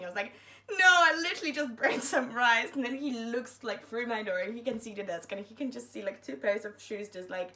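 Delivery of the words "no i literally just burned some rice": laughing excuse. Retold or imitated. Defending himself to porter.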